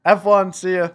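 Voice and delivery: loud, high mock-cheery sendoff; breezy brush-off. Son turning down dad's log cutting, loud breezy goodbye.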